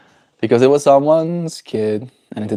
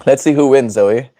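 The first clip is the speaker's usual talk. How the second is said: normal talking voice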